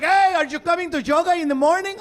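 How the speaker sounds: high, shouted club greeting; loud eager instructor. Acted. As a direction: call out brightly over the music. Julian greets him over club music.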